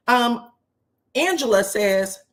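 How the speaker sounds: ordinary delivery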